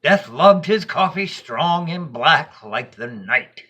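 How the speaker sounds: ordinary delivery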